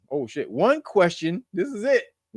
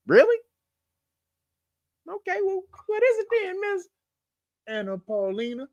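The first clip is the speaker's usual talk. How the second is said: much higher, incredulous squeal; mock disbelief; 12 semitones higher than usual. Comedian mocking the alien claim, high disbelieving voice. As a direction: act shocked and sceptical.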